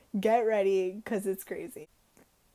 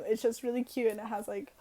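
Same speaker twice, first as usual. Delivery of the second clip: usual speaking voice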